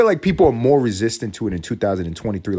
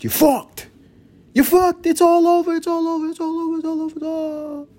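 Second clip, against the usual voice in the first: high mock wail; 3 dB louder than usual. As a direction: cry it out way up high, fading as you go. Mock wail of doom for new parents.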